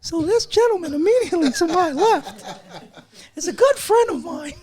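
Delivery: high goofy cartoon voice; squeaky high voice. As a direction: jump way up into a squeaky voice. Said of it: "Should I continue like that?".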